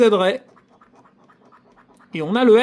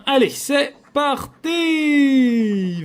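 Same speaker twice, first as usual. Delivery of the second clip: rallying shout; 6 semitones higher, much slower than usual. Real, not acted. Launching the next ticket.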